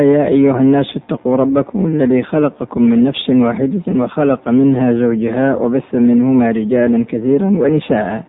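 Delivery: ordinary talk, nothing marked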